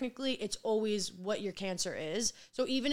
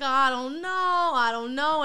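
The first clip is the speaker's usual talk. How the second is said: imitating his reluctance, higher and louder; mock-unsure, voice raised; retold hesitation; 5 semitones higher, 8 dB louder than usual. Telling how he first reacted to TikTok, mock hesitant. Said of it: "he was like".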